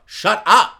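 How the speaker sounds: echoing Butcher's snap; curt shut up. Retold or imitated. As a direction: shut him down flat. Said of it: "I've got to agree with Butcher".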